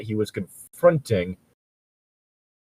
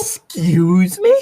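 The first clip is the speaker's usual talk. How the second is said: slow, higher, rising excuse me; drawn-out indignant excuse me; 8 semitones higher, 4 dB louder, much slower than usual. Real, not acted. Reacting to the fanfic, slow stunned excuse me. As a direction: stretch the outrage out. Repeated outrage.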